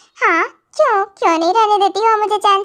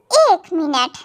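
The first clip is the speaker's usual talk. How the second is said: ordinary talk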